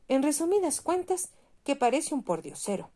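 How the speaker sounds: the mayor mocking the ragged statue; pompous mayor.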